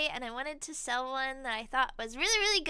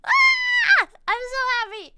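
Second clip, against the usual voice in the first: joyful shriek; 11 semitones higher, 14 dB louder than usual. Real, not acted. Merch finally out.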